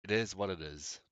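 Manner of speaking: ordinary talk, nothing marked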